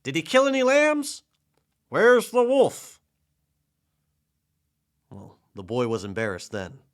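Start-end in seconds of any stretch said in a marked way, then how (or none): 0.0-3.0 very loud, high urgent questions; shouting. A narrator's reading. Villagers arriving to save the flock, urgent high shouting. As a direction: shout high and hard, drawing out the last question. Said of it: "they shouted".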